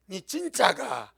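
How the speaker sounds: slow, amused Gyeongsang dialect question. Acted. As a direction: Gyeongsang dialect, teasing, with a laugh. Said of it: "경상도 말투로 말씀하십니다".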